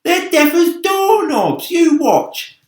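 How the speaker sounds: loud, high, mocking centipede voice; scornful cry. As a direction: crow it out, full of bravado. Centipede boasting loudly.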